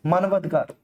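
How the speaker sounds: ordinary delivery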